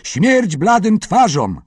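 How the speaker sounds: put-on children's war whoop; battle cry. A narrator's reading. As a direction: roar it like charging warriors. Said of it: "Z bojowym okrzykiem".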